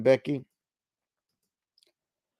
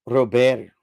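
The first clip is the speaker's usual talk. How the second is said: French accent; 5 dB louder than usual. Shown as a demonstration. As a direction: say Robert like a Frenchman. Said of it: "I think with French accent".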